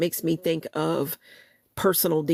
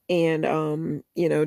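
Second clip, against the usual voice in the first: normal talking voice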